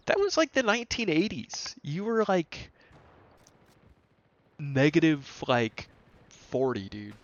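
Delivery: exasperated, voice climbing high; can't believe how absurd this is; incredulous outburst. Real, not acted. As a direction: pitch shoots way up in disbelief. Incredulous rant at absent coworker.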